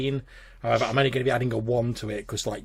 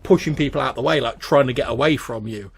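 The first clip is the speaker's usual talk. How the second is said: ordinary talk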